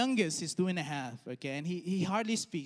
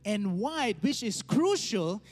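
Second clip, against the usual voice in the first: rising sermon delivery; 3 semitones higher than usual. Acted. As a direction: preach it with climbing pitch. Preaching the application.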